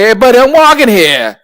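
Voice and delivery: put-on loud, high, brash New York accent; a pushy New York accent shouting "I'm walking here". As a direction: bark it like a New York cabbie. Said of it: "in, like, the most New York accent ever".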